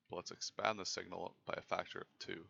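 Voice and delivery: ordinary talk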